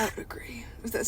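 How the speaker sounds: unvoiced, quick whisper; whispered agreement. Real, not acted. Friend teasingly agreeing the writing is bad, whispered. Whispered quip after joke.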